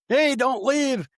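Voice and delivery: high, loud mock scream; shouting at the friend who runs off. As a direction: yell after him, then bail yourself. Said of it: "I'm screaming".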